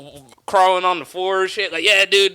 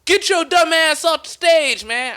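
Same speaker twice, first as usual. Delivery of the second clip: high, loud heckle from the back; heckler's shout; 12 semitones higher, 4 dB louder than usual. Retold or imitated. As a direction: yell it from the back of the room. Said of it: "some dude was like".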